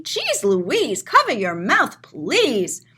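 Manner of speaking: ordinary delivery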